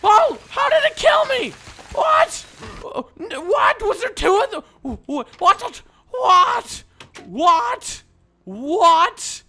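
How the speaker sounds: very high, loud disbelief; shocked screaming. Real, not acted. Gamer killed out of nowhere, shocked screaming.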